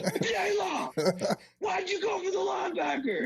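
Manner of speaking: strained, very high-pitched scream at normal volume; mock screaming coach. Coach rewinds film and confronts player, mock high screaming. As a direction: scream the question, hammed up. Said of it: "they start screaming".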